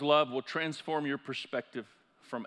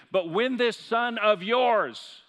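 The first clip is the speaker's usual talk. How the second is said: slow, loud, higher pitched indignant cry; angry brother yelling; 8 semitones higher, 7 dB louder, much slower than usual. Retold or imitated. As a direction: spit out each word at the father. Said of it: "is yelling at him".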